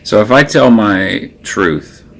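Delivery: usual speaking voice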